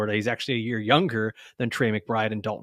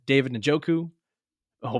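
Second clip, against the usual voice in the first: normal talking voice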